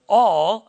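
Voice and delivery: "all" punched loud with a lift; loud, stretched "all"; stress on all. Real, not acted. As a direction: hit "all" hard and hold it. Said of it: "underline it".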